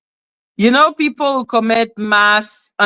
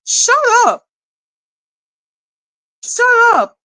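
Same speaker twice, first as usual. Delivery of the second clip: shouted shut up; 9 semitones higher than usual. Real, not acted. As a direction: yell it at her, pitch up high. Yelling at the streamer.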